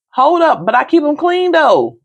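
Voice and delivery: high-pitched mock-defensive comeback; indignant protest. Daughter defending her old towels to her mother, high-pitched protest.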